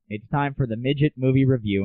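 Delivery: ordinary delivery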